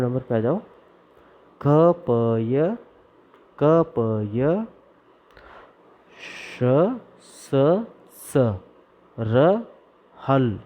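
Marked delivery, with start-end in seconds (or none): 1.7-10.6 drawn-out chant for the class; slow recitation. Shown as a demonstration. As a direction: say each sound slowly to be repeated. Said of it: "आप सब मेरे साथ में बोलिए।". (धीमा सस्वर पाठ)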